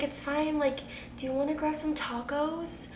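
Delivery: high, slightly soft coaxing; retold comforting. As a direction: coax a crying friend along. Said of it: "trying to calm her down".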